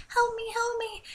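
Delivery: very high, soft puppy cry; tiny weak plea. Retold or imitated. Dalmatian puppy squeaks high and soft for help. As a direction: whimper for help. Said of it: "he was like".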